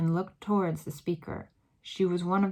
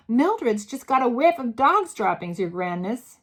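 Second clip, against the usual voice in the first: loud, higher reply; called back; 5 semitones higher, 7 dB louder than usual. A narrator's reading. Witch calling up to the Grand High Witch, loud. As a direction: call it up to the platform. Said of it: "someone called back to her".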